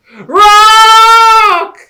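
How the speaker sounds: very high, loud cry; shrieked warning. Acted. Rabbit sees the rock, very high shriek.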